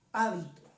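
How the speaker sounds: hábito with the first syllable HÁ stressed. Shown as a demonstration. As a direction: punch the opening "á" of hábito, letting the rest fall. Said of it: "la tilde en la primera A". (hábito con acento en la primera sílaba)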